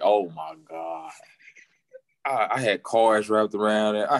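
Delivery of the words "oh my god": slow, drawn-out exasperation. Retold or imitated. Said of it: "I'm like".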